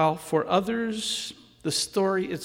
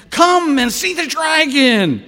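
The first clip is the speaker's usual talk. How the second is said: calling out; 9 semitones higher, 12 dB louder than usual. Acted. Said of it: "That was from the dean".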